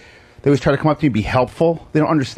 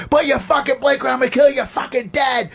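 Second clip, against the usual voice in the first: high-pitched yelling New York tough guy; Italian New York guy; 11 semitones higher than usual. Retold or imitated. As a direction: act macho like an Italian New Yorker in road rage. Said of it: "I do, like, the Italian New York guy thing".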